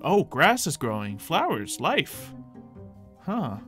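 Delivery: ordinary delivery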